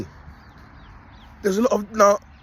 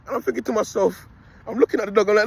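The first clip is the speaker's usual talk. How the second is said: normal talking voice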